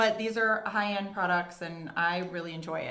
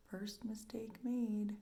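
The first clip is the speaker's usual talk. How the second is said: slow hushed whisper under the breath; 3 semitones higher, 10 dB quieter, slower than usual. Real, not acted. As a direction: whisper it to yourself while concentrating. Mistake while applying mascara.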